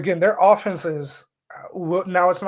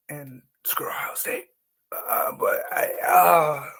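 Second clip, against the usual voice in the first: anguished whisper; slower than usual. Pretend. Anguish at picking the rival.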